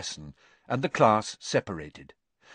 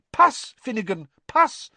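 very high, loud, insistent teacher; brisk teacher voice; 12 semitones higher, 7 dB louder than usual. A narrator's reading. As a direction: correct him sharply, pitched high. Voices Professor Sprout.

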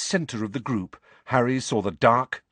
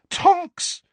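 high, loud cry of a name; exasperated cry; 6 dB louder than usual. A narrator's reading. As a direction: cry out at the clumsy culprit. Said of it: "cried Missus Weasley in exasperation".

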